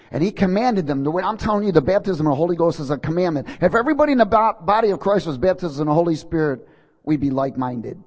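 loud, high, fast preaching climb; full-force preaching. Acted. Preacher insisting to the church, loud and fast. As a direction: drive it home like a revival preacher.

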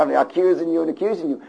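usual speaking voice

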